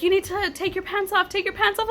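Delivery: high-pitched imitation of a dispatcher; retold urging. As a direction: urge it as the dispatcher would, voice lifted. Dispatcher's urgent order retold.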